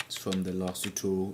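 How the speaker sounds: normal talking voice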